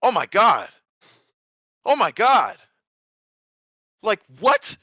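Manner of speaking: high, loud exclamations with pauses between; amazed disbelief. Retold or imitated. Swimmer looking up at the freed rope, loud acted amazement. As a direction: cry out, stunned that it worked.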